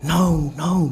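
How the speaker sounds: high, loud, emphatic no; retold refusal. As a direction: imitate her refusing outright. Retold refusal to hand baby.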